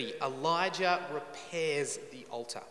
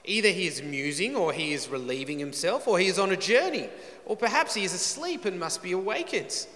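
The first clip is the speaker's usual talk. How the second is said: mocking; 3 semitones higher than usual. Elijah taunting the prophets of Baal, mocking. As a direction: goad them with lively, swinging mockery. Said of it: "Elijah mocked them".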